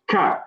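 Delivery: "Ka" with a plain English K; English K sound. Shown as a demonstration. Teacher answers which K it is, clear English K. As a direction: say "Ka" starting with a clean English k. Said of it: "it's the K like we have".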